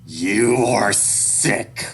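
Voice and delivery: very slow, loud condemnation; father's loud, deliberate disgust; hateful. A narrator's reading. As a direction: draw out each word with loathing. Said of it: "Dad speak with so much hate".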